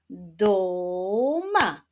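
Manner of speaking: "duma" with a long, stretched first syllable; drawn-out syllables. Shown as a demonstration. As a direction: reveal "duma" slowly, syllable by syllable. Reveals rebus answer syllable by syllable.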